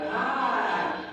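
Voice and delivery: imitated pained cry. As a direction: imitate a cry that stops short of screaming. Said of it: "it wasn't even a scream".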